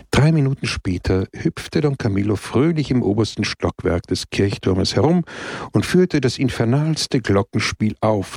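plain reading voice

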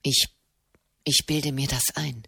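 low, soft, faltering disbelief; distraught. Acted. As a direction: murmur it to yourself, shaken. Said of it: "dachte Marchesano verstört".

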